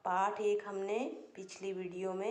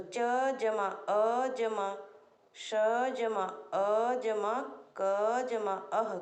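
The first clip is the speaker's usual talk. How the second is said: slow spelling out; slower than usual. Shown as a demonstration.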